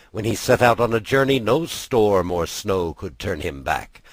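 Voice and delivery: usual speaking voice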